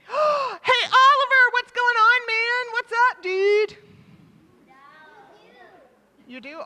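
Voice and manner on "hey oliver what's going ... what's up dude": loud, high, buddy-buddy hollering; rowdy casual greeting. Pretend. Talking over everybody at a fancy party, loud and casual. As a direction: yell it across the room like a pal. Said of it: "you don't say".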